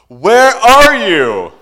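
high, loud, drawn-out call; yelled question. Retold or imitated. Rescuer yelling down the shaft, slow loud call. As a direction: stretch it out down the shaft. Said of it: "this is what they asked".

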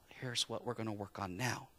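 gentle Jesus. Retold or imitated. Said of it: "he points out".

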